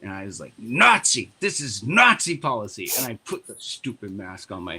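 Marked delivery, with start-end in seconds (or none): 0.6-2.9 frustrated outburst. Retold or imitated. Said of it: "I was very frustrated".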